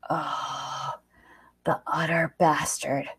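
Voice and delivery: hushed muttered aside; whispered curse. A narrator's reading. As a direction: breathe it out under your breath. Narrator-ring aside, muttered curse.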